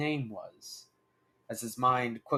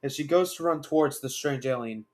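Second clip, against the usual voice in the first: plain reading voice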